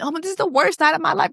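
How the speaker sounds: fast, high mock lament; imitating distraught wife. Recapper mimics crying wife, mock despair. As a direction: toss off her melodrama mockingly. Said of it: "the crying and the embarrassment".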